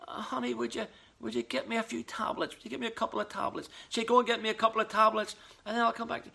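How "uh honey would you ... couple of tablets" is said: feeble, whimpering sick voice; weak pathetic voice. Retold or imitated. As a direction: play the helpless sick husband. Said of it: "most pathetic voice".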